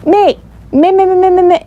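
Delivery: put-on high, loud excited child; rapid-fire high-pitched clamoring; eager exclaiming. A narrator's reading. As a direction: pipe up loudly, begging to be picked. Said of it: "Victoria exclaimed".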